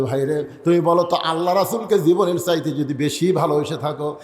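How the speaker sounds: ordinary talk, nothing marked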